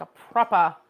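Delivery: mock British "proper" with no r, said as "propah"; a deliberately bad posh British "proper"; bad British accent. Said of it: "there's my really bad accent".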